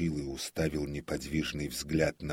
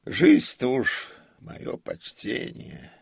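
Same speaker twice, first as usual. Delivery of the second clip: grumbling; 4 semitones higher, 5 dB louder than usual. A narrator's reading. Old comic alone in the dark theatre, grumbling. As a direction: grumble and tut at your own old age. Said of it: "продолжал ворчать".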